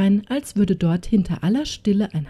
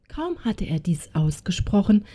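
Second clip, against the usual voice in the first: ordinary delivery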